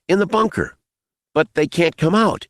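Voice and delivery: put-on high-pitched boy calling back; boy's voice. A narrator's reading. Boy warning armed man, boyish call. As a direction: answer in a high young voice. Boy answers across the dark.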